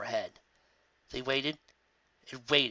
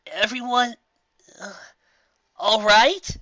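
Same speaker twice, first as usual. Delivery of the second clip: dazed call; 9 semitones higher, 6 dB louder, slower than usual. Acted. Dazed after crash landing.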